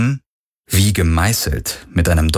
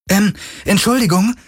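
very high, hesitant female voice; high-pitched tentative interjection; thin woman calling; 13 semitones higher than usual. A narrator's reading. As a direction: pipe up hesitantly from the group. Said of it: "rief eine dünne Frau".

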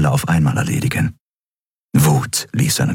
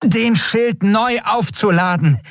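much higher, strained officer voice; Offiziersfigur im Dialog; slower than usual. Acted. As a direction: report in a high put-on voice. (hohe, angespannte Offiziersstimme)

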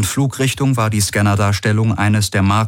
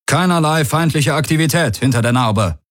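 raised, formal status report; crew report; 8 semitones higher than usual. A narrator's reading. Crew member reporting all clear, high formal voice. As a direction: call it out across the bridge. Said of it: "meldete ein Crewmitglied".

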